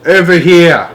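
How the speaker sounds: high, loud answering call; trapped father answering his son's call. A narrator's reading. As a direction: call back from far away. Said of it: "the voice called out".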